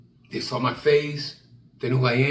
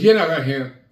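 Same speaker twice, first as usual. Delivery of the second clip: bouncer; 9 semitones higher, 6 dB louder than usual. Retold or imitated. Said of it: "He goes".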